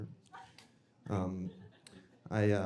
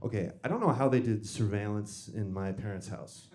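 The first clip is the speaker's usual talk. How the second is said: usual speaking voice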